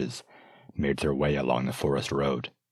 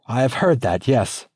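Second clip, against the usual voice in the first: curt princess; 8 semitones higher, 6 dB louder than usual. Acted. Princess concedes bitterly.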